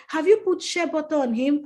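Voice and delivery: a hint of the sister's high, urgent voice; sister's question. Sister asks on the phone.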